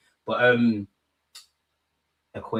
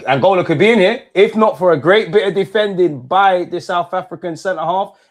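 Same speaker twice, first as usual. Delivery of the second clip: raised, loud match call; excited live commentary; 5 semitones higher, 8 dB louder than usual. Real, not acted. Commentator calling Angola's near equaliser, loud and excited. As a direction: call the near miss with rising urgency.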